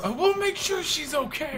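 high, loud earnest promise; mock heroic Luigi. Voicing Luigi reassuring Harry, high and loud. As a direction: swear it like a melodramatic hero. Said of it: "voicing Luigi reassuring".

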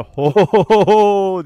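loud hammed-up "Ho, ho, ho" bellow; mock shout. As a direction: belt out the ho ho ho like a game villain. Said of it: "diye bağırdı". (oyundaki karakteri taklit ederek yüksek sesle "Ho, ho, ho")

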